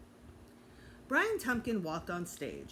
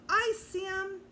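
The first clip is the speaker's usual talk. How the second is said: high eager shout from the audience; a kid in the audience calls out to the author; calling out; 12 semitones higher, 4 dB louder, slower than usual. A narrator's reading. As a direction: yell it from the front row. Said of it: "Someone down front called out".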